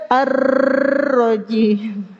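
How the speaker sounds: AR-ROJIM with a long rolling r; overdone ro trill. Shown as a demonstration. Teacher showing the excess, exaggerated trilled r. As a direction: roll the r of AR-ROJIM far too long.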